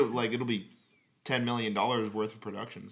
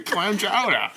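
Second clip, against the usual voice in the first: hammed-up Boston accent, a bit louder and higher; Ted Boston impression; 8 semitones higher, 6 dB louder, slower than usual. Joking to podcast friends, doing Ted in a hammed-up Boston accent. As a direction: play a loud Boston bro cheering in a thick Boston accent. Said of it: "That's a pretty good Ted impression".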